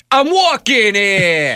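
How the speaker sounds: high, drawn-out Brooklyn yell; Brooklyn accent. Shown as a demonstration. Co-host asked to do Brooklyn, exaggerated Brooklyn accent. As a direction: stretch it out in a hammed-up Brooklyn accent. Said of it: "You do a Brooklyn accent?".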